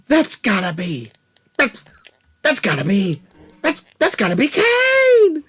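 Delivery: high, stretched-out hype call building to a shriek on Kane; wrestling announcer call. Retold or imitated. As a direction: bellow it like a ringside commentator spotting the big man. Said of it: "it's something from wrestling. There's a guy named Kane".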